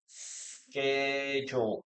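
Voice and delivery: "Schedule" stretched very slowly, sskedge-ul, K sound kept; slow American schedule. Shown as a demonstration. Teacher drills the K sound for students, slowly. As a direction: say "Schedule" very slowly, hissing the opening S into a K. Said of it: "We have a letter K here".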